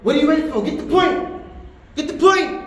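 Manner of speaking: high, urgent goading shout; shaitan egging on. Acted. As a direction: urge them on impatiently, pitched high. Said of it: "The shaitan, he'll say".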